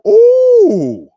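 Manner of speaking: a long, loud, high-pitched ooh; high excited ooh. Real, not acted. Streamer reacting to viewer's stir fry, high loud ooh. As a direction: squeal with delight at the food.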